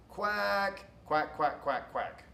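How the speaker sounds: quacks stepping down in pitch; spoken quack rhythm. Shown as a demonstration. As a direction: mimic a duck's quack sequence. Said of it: "a call that consists of five or six repetitions".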